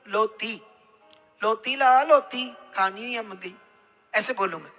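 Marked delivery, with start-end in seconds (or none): 0.1-3.5 lisped 'roti' coming out as 'loti'; garbled consonants, 'r' turned into 'l'; imitated speech impediment. Shown as a demonstration. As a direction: demonstrate muddled, mispronounced words. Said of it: "भद्दा हूं या हकला हूं, तो मैं बोलूंगा".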